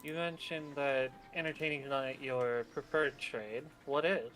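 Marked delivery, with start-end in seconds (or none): none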